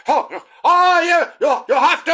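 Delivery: manic Irish preacher, loud and high; Irish accent. Acted. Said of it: "put it on an Irish accent".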